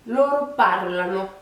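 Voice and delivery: "Loro parlano" with heavy weight at the start; first-syllable stress. Shown as a demonstration. Teacher contrasting it with io parlo, "Loro parlano" stressed early. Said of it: "Donc vraiment plus accentué au début".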